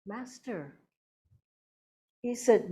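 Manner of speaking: ordinary talk, nothing marked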